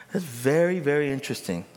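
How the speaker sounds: ordinary talk, nothing marked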